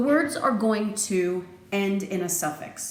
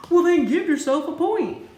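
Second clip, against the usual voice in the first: excited praise; 9 semitones higher, 6 dB louder than usual. Acted. Cheering the student's right answer.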